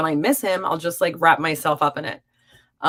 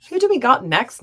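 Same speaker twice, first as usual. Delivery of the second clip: higher and louder, bright announcement; loud call-out; 7 semitones higher, 6 dB louder than usual. Real, not acted. Host calling out to her live chat, energetic. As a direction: call it out to the whole room.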